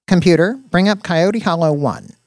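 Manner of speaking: high, loud voice command; computer command. Acted. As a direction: call out an order to the machine.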